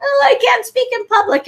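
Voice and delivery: high-pitched tearful wail, imitated; hammed-up crybaby complaint; mock crying. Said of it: "crying like".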